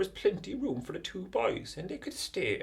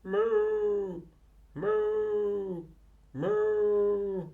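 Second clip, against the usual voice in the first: loud drawn-out mooing like a cow; 4 dB louder, much slower than usual. Acted. A cow lowing in the night, loud moos. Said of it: "recognise the noise".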